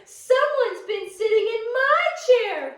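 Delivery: Mama Bear finding her chair used, high and loud; crying out Mama Bear. Acted. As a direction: cry out in dismay. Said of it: "cried Mama Bear".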